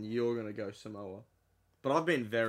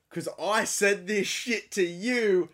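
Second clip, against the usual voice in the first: higher, louder, slow mock threat; mock rant; 9 semitones higher, 7 dB louder, slower than usual. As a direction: ham up a fake tirade.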